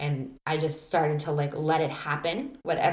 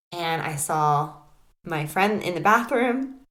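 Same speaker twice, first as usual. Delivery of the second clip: usual speaking voice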